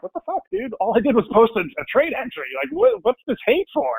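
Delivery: high, incredulous retold outburst, half-acted; exasperated outburst. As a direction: throw up your hands in mock disbelief. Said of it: "And you're like".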